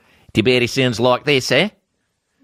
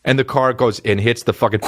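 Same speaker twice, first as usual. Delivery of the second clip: usual speaking voice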